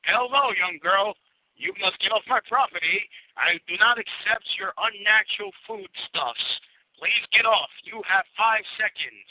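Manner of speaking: high, stern threatening voice; gruff threatening man. Acted. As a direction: bark warnings at the kid. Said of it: "Russ Dove shoots people in the face".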